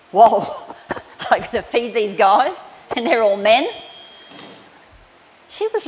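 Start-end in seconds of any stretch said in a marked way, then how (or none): 0.1-3.7 louder, comic alarm at the crowd; flustered panic. Retold or imitated. Martha panicking over feeding Jesus' disciples, loud comic alarm. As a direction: play Martha overwhelmed, loud. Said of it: "poor Martha's probably going".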